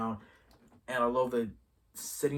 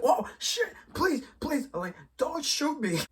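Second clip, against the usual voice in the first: much higher, louder squeaky begging; mock panicked pleading; 6 dB louder, slower than usual. Hayward begging the soldiers not to shoot, high panic. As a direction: beg for your life in a comic falsetto. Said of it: "And he's like".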